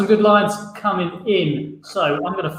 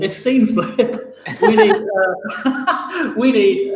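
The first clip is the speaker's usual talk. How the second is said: laughing through the words; can't stop laughing mid-sentence; 3 semitones higher, 3 dB louder, much slower than usual. Real, not acted. Laughing while announcing.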